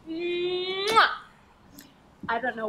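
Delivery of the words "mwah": smacking kiss. Acted. Woman kisses the tutor.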